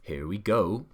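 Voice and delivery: ordinary delivery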